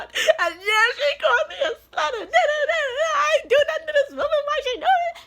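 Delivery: high, strained voice breaking into sobs and laughs; cry-laugh through the story, voice cracking. Real, not acted.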